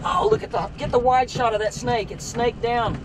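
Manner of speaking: ordinary talk, nothing marked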